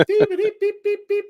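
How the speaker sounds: high bleeping beeps, playfully; spoken morse code. Pretend. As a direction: chirp the beeps like a censor tone. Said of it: "sound like Morse code".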